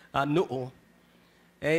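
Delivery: ordinary talk, nothing marked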